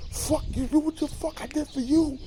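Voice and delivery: high, shrieking curses hurled at his mother; retelling Franklin cursing out his mother, shrieking rage; furious screaming tirade. Retold or imitated. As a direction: scream it like he's lost his mind. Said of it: "Franklin goes in the, in the fucking tirade, going crazy".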